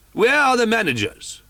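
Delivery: commissary barking for the managers; demanding call. Acted. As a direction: call out over the crowd.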